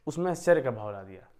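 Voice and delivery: normal talking voice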